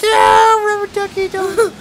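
very high, loud anguished scream. Real, not acted. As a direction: wail it in despair. Screaming as the duck loses.

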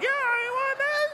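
very high, hammed-up kid voice; mock a clueless child's squeal; bratty kid. Child with no brain answering the giant, high bratty mimicry.

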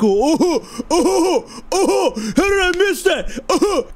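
high, hammed-up wail at himself; mock wailing. Player who missed an obvious clue, mock despair. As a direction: lament your own stupidity in exaggerated whoops.

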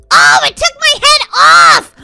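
high, loud drawn-out cry of alarm; shocked yell. Real, not acted. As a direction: yell it in playful horror. Game takes her head off.

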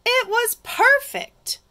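high-pitched burst of delight; thrilled, voice way up; delighted narration. A narrator's reading. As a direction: squeal it with storybook joy. Delight at the found shell.

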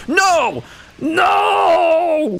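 high, drawn-out cry of no; dismayed shout. Real, not acted. Cries out as play goes wrong.

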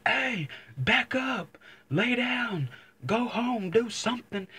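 high, slow shouted commands; yelling commands at dog. Retold or imitated. Man ordering a pit bull to go home, high slow yell. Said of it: "I'm over here fucking yelling at the dog".

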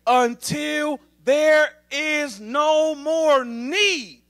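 very slow, stretched, ringing words; slow-motion scripture climax; drawn-out proclamation. Real, not acted. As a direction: hold every word out for the congregation. Preacher proclaims the climax of the verse.

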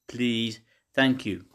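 normal talking voice

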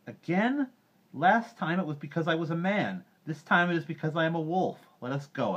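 high, loud protest to a crowd; indignant retort. A narrator's reading. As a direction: call back bitterly at the villagers. Mowgli answers the hostile crowd.

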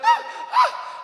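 very high, loud mock moans; imitated moaning. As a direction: imitate the woman on the phone in two high cries. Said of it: "she starts going".

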